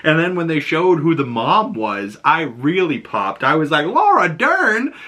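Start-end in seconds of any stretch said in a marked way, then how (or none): 3.8-4.9 high, drawn-out fan excitement; thrilled recognition. Retold or imitated. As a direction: stretch the name out high in amazement. Really popped at casting reveal.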